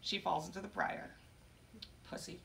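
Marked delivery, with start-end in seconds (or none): 2.1-2.4 very soft, short aside; muttered insult. Acted. Poet insults fallen Sleeping Beauty, in a quiet mutter. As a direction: drop the insult under your voice.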